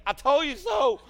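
very loud, high mock gloating; retold I-told-you-so, yelled at high pitch; imitating triumphant outburst. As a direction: cry out her vindication in imitation.

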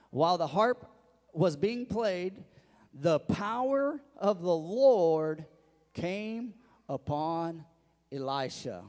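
very slow, weighty proclamation; scripture reading. Acted. Pastor reading verse fifteen to the congregation, slow and emphatic. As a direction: draw out each phrase of the verse.

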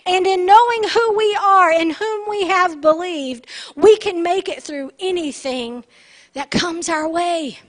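emphatic pulpit delivery; full-force preaching. Real, not acted.

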